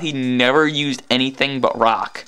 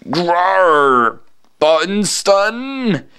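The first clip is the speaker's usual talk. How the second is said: a growled roar, then a slow, higher drawn-out question; horrible monster voice; 6 semitones higher, much slower than usual. Acted. Villain booms at the heroes, a horrible growling roar. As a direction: play the unseen villain roaring in. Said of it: "where'd that horrible voice come from".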